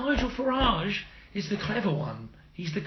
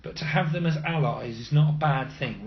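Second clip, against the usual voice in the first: ordinary talk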